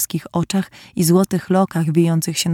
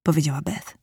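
ordinary delivery

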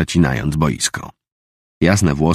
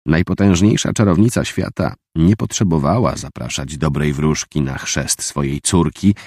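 plain reading voice

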